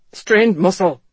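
muffled into his knees. A narrator's reading. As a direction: speak muffled, face down. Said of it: "in a muffled voice".

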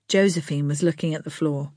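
ordinary delivery, nothing marked

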